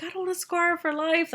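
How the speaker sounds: high-pitched worried protest; retold panic. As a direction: re-enact a fretting mother, pitch jumping up. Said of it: "And I'm, like, panicking".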